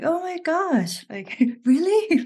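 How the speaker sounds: high, laughing "oh my gosh, really?"; surprised in English. Retold or imitated. Laughing surprise while telling a friend about slipping into English. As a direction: squeal it out in amused disbelief. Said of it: "I'm surprising in English".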